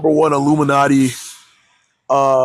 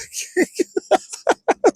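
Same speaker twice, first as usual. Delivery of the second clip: high squealing laugh. Real, not acted. Laughing at himself after mixing up the dates, high giggle. Laughing at his own mix-up.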